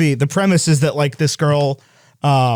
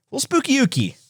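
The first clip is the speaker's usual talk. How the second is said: playful spooky; 10 semitones higher, 3 dB quieter than usual. Pretend.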